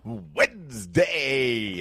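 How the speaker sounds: stretched high, loud exclamation; hyped announcer. Acted. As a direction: draw it out like big news. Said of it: "make Wednesday sound as exciting as possible".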